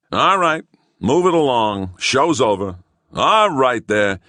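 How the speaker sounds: loud high call, slow at the end; policeman ordering. Acted. Policeman ordering the crowd to disperse. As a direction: clear the street with authority.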